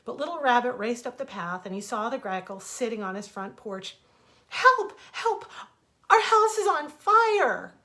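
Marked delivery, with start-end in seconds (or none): 4.5-7.7 very high, loud pleading yell; desperate cry. Acted. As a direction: beg for rescue at full voice. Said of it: "he cried".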